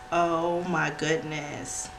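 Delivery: ordinary delivery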